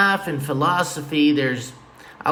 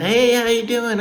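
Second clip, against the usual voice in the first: chirpy greeting; 8 semitones higher, 4 dB louder than usual. Retold or imitated. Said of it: "I'm like".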